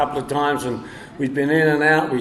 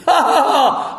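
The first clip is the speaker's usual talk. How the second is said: high mocking "ha ha ha"; imitated laughter; 10 semitones higher, 4 dB louder than usual. Sponsor laughing at him on the phone, high mocking laugh. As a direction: cackle up high like the friend. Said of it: "He's laughing at me".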